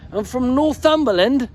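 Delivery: "Northumberland" in a high put-on British accent. Shown as a demonstration. Vlogger trying out a British accent for his fan. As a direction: read "I'm from Northumberland" like a Brit. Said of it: "I should read it like this".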